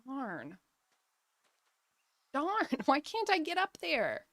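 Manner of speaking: usual speaking voice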